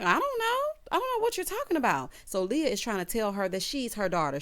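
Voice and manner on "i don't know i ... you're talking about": much higher, quick denial; flustered denial. Acted. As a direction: deny it in a nervous rush. Character denies, flustered.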